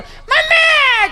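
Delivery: long, high, loud cry for mummy; child's wail. Retold or imitated. Fallen child calling mother. (પડી ગયેલા બાળકની ઊંચી લાંબી બૂમ)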